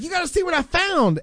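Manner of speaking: high, gleeful mock delight; imitating happy coworker. Host mimics coworker showing off dead bat, gleeful and high. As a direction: gush like it's the best day ever. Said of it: "she's like".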